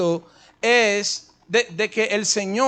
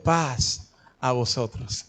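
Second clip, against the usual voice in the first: voz de Jesús; 8 semitones lower, 5 dB quieter, slower than usual. Retold or imitated. Jesús saluda a los discípulos.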